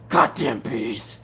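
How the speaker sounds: low, slow grumbled curse at the bees; imitating Mister Coffee. Mimicking Mister Coffee cursing the bees, low and slow. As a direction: growl it out low and slow like an annoyed man. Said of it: "And he'll just be like".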